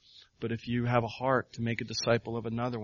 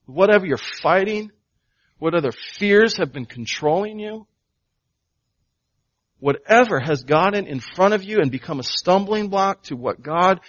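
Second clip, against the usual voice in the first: raised, louder pulpit voice pressing the point; full-force preaching; 5 semitones higher, 10 dB louder than usual. Acted. Pastor urging the congregation to trust Jesus, full-force preaching. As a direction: preach it hard to the whole room.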